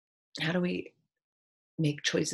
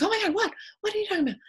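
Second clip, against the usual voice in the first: mock disbelief; much faster than usual. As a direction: act stunned, pitched way up. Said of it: "I was like".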